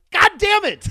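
very high, very loud cry of defeat; frustrated outburst. Retold or imitated. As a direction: yell when caught out. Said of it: "I'm like".

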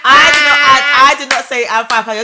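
loud denial. Real, not acted. Arguing with her friend, loud insistent denial. Heated argument, denying.

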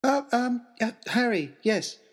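flustered, tripping over the first sounds; stammering. A narrator's reading. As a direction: caught off guard, stumbling to answer. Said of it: "stammered Harry".

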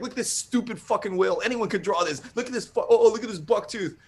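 high, scornful wrestler voice; Roddy Piper. Retold or imitated. Wrestler holding up the artist's character, mocking promo tirade. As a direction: imitate a wrestler sneering at a cartoon. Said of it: "Can you cut promos".

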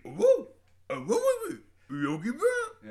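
wild high cartoon bear whoops; talking bear. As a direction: hoot like a trained bear on the phone. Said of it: "a bear on the other end that had been trained to speak".

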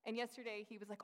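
ordinary talk

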